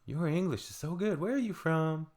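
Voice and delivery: lightly voiced tourist lady. Older American tourist complimenting a helpful stranger, lightly voiced lady. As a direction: lift the pitch for a mildly impressed tourist.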